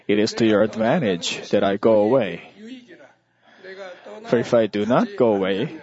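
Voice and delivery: ordinary talk, nothing marked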